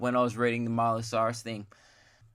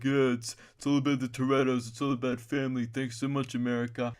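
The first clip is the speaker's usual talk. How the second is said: slurred, mumbled victory speech; slurring Vin Diesel. Acted. Vin Diesel giving his victory speech, slurring. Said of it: "he'd be slurring his way through".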